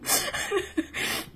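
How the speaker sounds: high breathy giggle. Real, not acted. Reader giggling at her own weirdness. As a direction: giggle at yourself. Laughs at herself.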